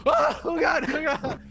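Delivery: high panicked cries. Acted. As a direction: squeal in sudden panic. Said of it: "as soon as they touch me".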